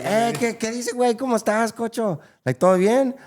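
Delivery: high, loud, eager greeting in Spanish; friendly greeting. Retold or imitated. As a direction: greet a stranger like an old friend. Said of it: "I'll be like".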